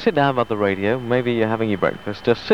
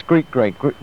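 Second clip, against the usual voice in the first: ordinary talk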